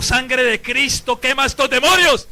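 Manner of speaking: loud, high, slow-building command; commanding invocation. Retold or imitated. Priest re-enacting his plea over Cindy, commanding cry. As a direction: call it down forcefully on the demons. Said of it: "cuando pedí la sangre de Cristo".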